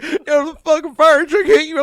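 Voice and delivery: very high, loud retold alarm; mock frantic passenger. Back-seat passenger tells the driver what hit them, mock frantic. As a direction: imitate a passenger yelling in shock. Acts out shocked passenger after crash.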